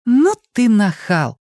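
mock-offended girlfriend; playful scolding. A narrator's reading. Fortuna reacts to him lying on her. (шутливый упрёк)

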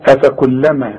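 ordinary talk

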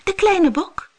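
a tiny goat answering; little goat. Acted. Little goat answers the monster in a high voice. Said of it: "zei het bokje".

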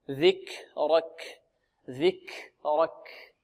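"ذكرك" slow and soft with audible breath on kaf; breathy kaf demo. Shown as a demonstration. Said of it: "هذا جريان النفس". (نطق "ذكرك" بهمس الكاف وجريان النفس)

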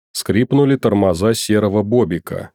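usual speaking voice

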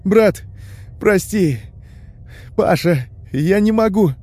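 wailing apology through a door; desperate pleading. A narrator's reading. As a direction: beg forgiveness in broken bursts. Tolik begs forgiveness behind the door.

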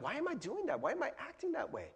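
high, hushed bewilderment; acted dismay, high and small; dismayed self-questioning. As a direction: ask yourself in a soft, high voice. Acted self-questioning after being mean.

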